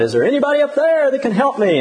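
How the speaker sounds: high, calling out for help; mock yell of a man hanging from a gutter; desperate shout. Joke's dangling man calling to the sky, hammed-up high shout. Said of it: "he shouted".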